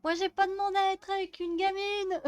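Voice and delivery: nagging husband. Acted. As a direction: play the fed-up husband nagging her. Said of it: "en mode".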